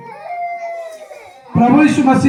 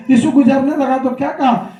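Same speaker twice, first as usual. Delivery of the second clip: usual speaking voice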